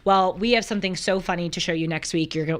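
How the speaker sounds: ordinary talk, nothing marked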